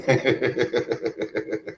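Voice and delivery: low, quiet real chuckle. Real, not acted. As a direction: chuckle softly and low in a long run. Amused while thanking viewers.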